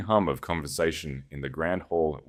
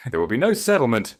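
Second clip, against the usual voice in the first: firm, higher and louder, every word clear; 6 semitones higher, 7 dB louder, faster than usual. A narrator's reading. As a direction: lay it down as final. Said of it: "I said clearly".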